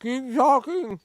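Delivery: talking with a mouthful; words around a bite of popcorn; munching popcorn. Real, not acted. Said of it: "I'm eating popcorn".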